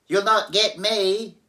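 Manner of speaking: high, loud defiant piglet. Acted. Pig taunts the wolf, high and defiant. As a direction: cry it out, high and cocky. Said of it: "the piggy cried".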